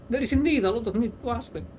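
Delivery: ordinary talk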